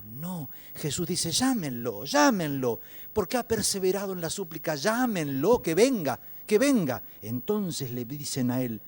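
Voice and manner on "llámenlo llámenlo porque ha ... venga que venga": rising, loud calling-out; insistent summons. Acted. Jesus ordering that the blind man be called, loud summons. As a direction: summon him, louder and higher with each phrase.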